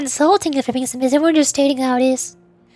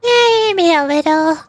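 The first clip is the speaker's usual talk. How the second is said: high, flustered little companion; excitable Paimon; 6 semitones higher than usual. Acted. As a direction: protest in a high, indignant squeak. Voices Paimon in game dialogue.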